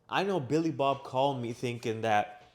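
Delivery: usual speaking voice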